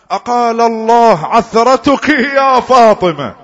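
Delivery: high, slow, loud cry; emotional calling out. Acted. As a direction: cry out the Prophet's call to his daughter. Prophet calls out three times.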